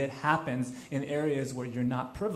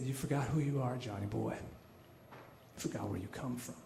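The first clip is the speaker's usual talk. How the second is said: low, soft retold reproach, sinking at the end; quiet reproach; 5 semitones lower, 9 dB quieter than usual. Buddy looks him straight in the eye, quietly reproachful. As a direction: deliver the buddy's verdict softly, pitch dropping away.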